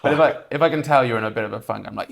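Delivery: ordinary talk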